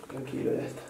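whispered hush to a scared cat; calm him in a breath; gentle whispered comfort; soothing whisper. Real, not acted. Soothing cat before bath.

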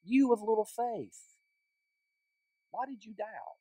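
soft, hushed reproof, almost to himself; private rebuke. Acted. Jesus quietly rebuking Peter as he sinks, hushed. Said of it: "He called him on it".

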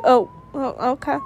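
ordinary talk, nothing marked

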